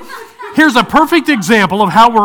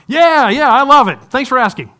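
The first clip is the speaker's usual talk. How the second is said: cheerful; 6 semitones higher, 5 dB louder than usual. Retold or imitated. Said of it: "they're just like".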